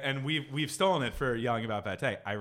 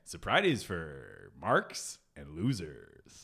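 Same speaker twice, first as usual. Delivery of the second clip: slogan read; 4 semitones lower, slower than usual. Acted. Mock sponsor slogan.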